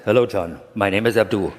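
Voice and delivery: imitating an Indian spirit guide's accent, lightly; a lightly put-on Indian guru accent, with a laugh at the end; mock Indian accent. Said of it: "this Eastern Indian ... that voice".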